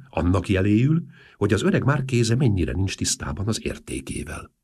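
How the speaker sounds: ordinary delivery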